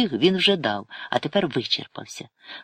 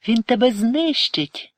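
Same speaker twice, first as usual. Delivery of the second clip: high, slow tearful cry; 10 semitones higher, 4 dB louder, slower than usual. A narrator's reading. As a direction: wail it in fear for him. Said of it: "заплакала Ірина".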